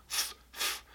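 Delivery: f f soprados, lábio nos dentes; isolated f sound. Shown as a demonstration. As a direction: sopro de f repetido. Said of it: "quem faz esse som é o seu lábio de baixo". (som de f exagerado)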